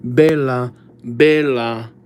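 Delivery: Bella said the Italian way, lightly; a quick Italian-flavoured "Bella"; playful Italian accent on "Bella". Shown as a demonstration. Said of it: "I turn Italian whenever I say Bella".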